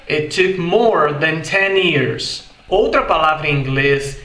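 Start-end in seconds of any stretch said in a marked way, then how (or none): 0.1-2.5 slow American "more" with a curled R; American English, R fully sounded; American accent. Shown as a demonstration. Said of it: "Já no inglês americano fica". (inglês americano lento, com R)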